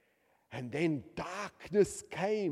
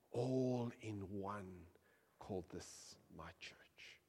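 slow, hushed whisper; 7 semitones lower, 11 dB quieter, slower than usual. Acted. Preacher whispering the verse to the congregation, hushed. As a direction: breathe the words like a secret.

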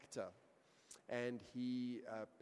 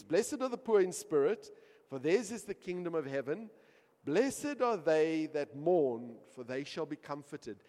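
earnest reading; 5 semitones higher, 11 dB louder than usual. Retold or imitated. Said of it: "he opens the Bible and he reads".

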